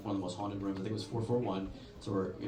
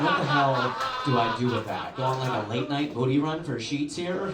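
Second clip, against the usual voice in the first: louder, indignant outburst; 2 semitones higher, 6 dB louder than usual. Retold or imitated. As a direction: rant in disbelief. Loud indignant rant.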